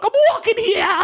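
high, hammed-up New York cabbie yell; mock New Yorker stepping into traffic; New York accent. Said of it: "I'm gonna slap a horse and be like".

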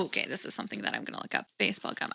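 soft unvoiced muttering; whispering. Real, not acted. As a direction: mutter to yourself while searching. Muttering while looking it up.

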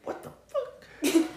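put-on high, slow, airy kid voice; dad imitating his son's bad word from daycare. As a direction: play the little boy repeating a bad word. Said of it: "he was like".

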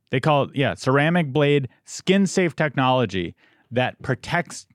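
high, loud, hyped ad read. Acted. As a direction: sell it like a pitchman. Sponsor ad read.

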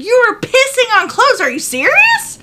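high, loud, outraged questions; outraged disbelief. Retold or imitated. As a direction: yell at him in utter disbelief. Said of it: "I was like".

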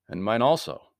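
usual speaking voice

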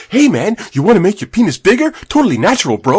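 high, loud mock huckster; pushy spam pitchman. Spam guy accosting a man in the mall, pushy.

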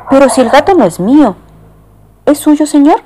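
high, fast protest; flustered lady. Acted. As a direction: fuss that it isn't hers. Lady and conductor exchange.

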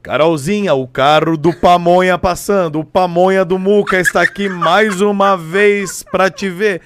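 loud, slow, drawn-out street announcement; pamonha car announcer. Acted. Calling Carol to the gate, pamonha car loudspeaker voice. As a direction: serenade her from the van with a booming vendor call. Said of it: "Uma pamonha pra Carol".

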